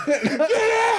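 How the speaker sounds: squealed very high through laughter; laughing repeat. Retold or imitated. Teller repeating the boss's yell, laughing. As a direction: echo the yell while cracking up.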